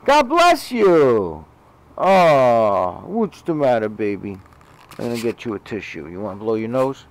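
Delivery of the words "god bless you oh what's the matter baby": sing-song bless you. Real, not acted. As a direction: call it out fondly after the sneeze. Cat just sneezed.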